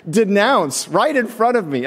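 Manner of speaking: stunned disbelief at the man; mock outraged disbelief; incredulous. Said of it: "I was like".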